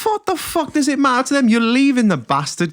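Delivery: high-pitched indignant rant; exasperated outrage. Real, not acted. Host reacting to co-host's airport story, in comic outrage. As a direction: blurt it out in incredulous indignation.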